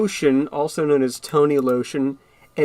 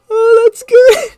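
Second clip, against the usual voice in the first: high wheezing laugh; 12 dB louder than usual. Real, not acted. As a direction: gasp it out mid-laugh. Laughing at own joke.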